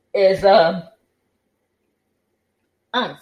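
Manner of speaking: talking through a yawn; sleepy yawning words. Real, not acted. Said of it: "I keep yawning".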